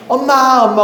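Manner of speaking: put-on high, eager child's call; a little girl calling out for mom. Child calling her mother. (엄마를 부르는 아이의 높은 목소리)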